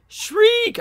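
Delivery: very high, piercing mock shriek. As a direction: screech it like a bird of prey. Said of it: "she's yelling shriek or if she's shrieking".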